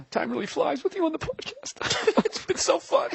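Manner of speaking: high quavering mock emotion; hammed-up tearful farewell; fake welling up. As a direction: pretend to choke up on the podcast. Fake crying to Paul.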